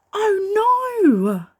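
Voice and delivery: high, loud cry of alarm. Acted. Reader to children, alarmed as Sophie nears the step. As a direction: gasp it out as if she's about to fall.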